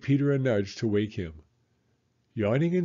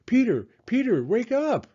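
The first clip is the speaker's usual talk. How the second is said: high, loud call to wake him; crying out; 11 semitones higher, 5 dB louder than usual. A narrator's reading. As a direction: shout him awake. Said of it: "cried Judy".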